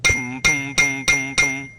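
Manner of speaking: loud thumping knocks; knocking sounds. Shown as a demonstration. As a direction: knock knock on wood. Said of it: "A few seconds pass by".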